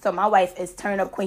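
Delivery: usual speaking voice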